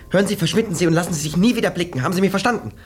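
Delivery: rushed, insistent warning; angry dismissal. Acted. Police lieutenant orders mademoiselle to disappear, angry and fast.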